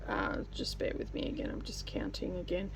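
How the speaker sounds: whispered while counting; under her breath, counting; whispering. Real, not acted. Quietly counting stitches mid-video.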